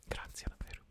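soft off-mic whisper; hushed aside; whispered thanks. Real, not acted. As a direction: breathe a quiet thank you.

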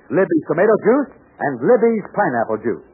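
ordinary talk, nothing marked

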